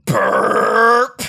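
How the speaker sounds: big rumbling burp; hammed-up burp; loud burp. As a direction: belch it out.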